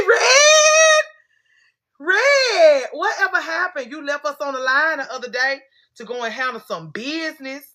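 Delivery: very high laughing shriek; excited shriek. Real, not acted. Shrieks greeting at viewer.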